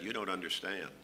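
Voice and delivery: soft, low confession to the lady; a hushed deadpan reveal; quiet deadpan punchline. Retold or imitated.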